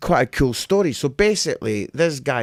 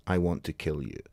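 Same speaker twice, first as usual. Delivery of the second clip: low, softer mock menace; mock threat; 8 semitones lower, 8 dB quieter than usual. Comic translates heckler's friendly shout, low mock threat. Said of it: "it sounded like".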